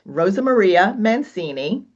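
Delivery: usual speaking voice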